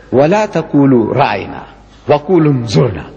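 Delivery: quick fluent Arabic recitation of the verse; تلاوة سريعة; Quran recitation. Shown as a demonstration. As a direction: rattle off the Arabic verse fast, by heart. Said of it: "Allah tells us in the Quran".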